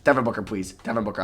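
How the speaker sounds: ordinary delivery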